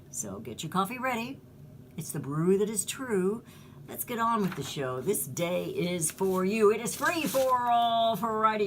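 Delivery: rhythmic radio patter building to a high call; show announcer. Acted. Host kicks off open-call Friday, rhythmic announcer style. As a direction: sell the rhyme, stretching the final slogan.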